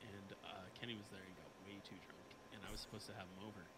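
very soft, distant-sounding storytelling; too far from mic. Real, not acted. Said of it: "talk a little bit closer to your mic".

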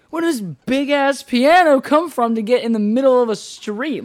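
high, loud, exasperated demand; incredulous shout. Retold or imitated. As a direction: yell it in disbelief at the friends. Exasperated retold question.

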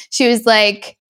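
usual speaking voice

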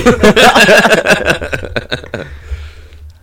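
high, loud burst of laughter; a long, high cackling laugh. Real, not acted. Co-host reacts to the beards remark, laughing loud and long.

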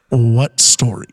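what story, shouted in disbelief; loud outraged question. Real, not acted. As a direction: bellow it in outrage at the show.

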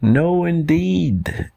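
slow, high mock yell. Two animals reject kangaroo's plan, drawn-out high mock yell. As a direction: draw out a high-pitched refusal. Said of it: "yelled the other two in unison".

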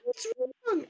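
put-on high, innocent little boy's question; innocent child. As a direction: ask it like a puzzled toddler. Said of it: "And he goes".

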